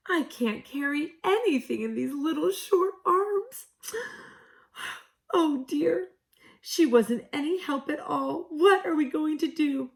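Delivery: high, crying plea of a little kangaroo; tearful. A narrator's reading. Katy crying to Missus Monkey. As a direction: wail it out through tears, pitch high. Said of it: "cried harder than ever".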